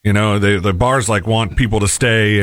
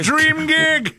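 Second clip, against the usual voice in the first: high, delighted squeal; sarcastic high-pitched glee; mock excitement. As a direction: gush like it's a dream come true. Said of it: "And I was like".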